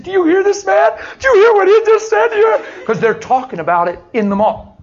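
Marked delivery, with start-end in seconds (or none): 0.0-2.6 high, loud, astonished cry; amazed exclaiming. Retold or imitated. A mother crying out to her daughter in loud, high amazement. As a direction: blurt it out in disbelief.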